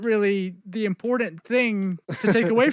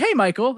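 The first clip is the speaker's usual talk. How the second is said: "Hey, Michael" with a rising question tune, a little higher; 5 semitones higher than usual. Shown as a demonstration. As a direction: call "Hey, Michael" as if asking. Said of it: "I have to say it like that".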